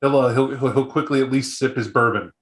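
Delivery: usual speaking voice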